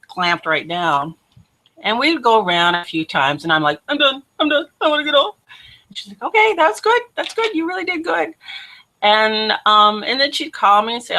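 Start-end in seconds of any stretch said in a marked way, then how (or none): 3.9-5.4 higher-pitched mock plea to stop; retold pleading. Begging to get off horse. 6.2-8.3 very high, sing-out praise; encouraging friend. Retold or imitated. Friend encouraging a scared rider.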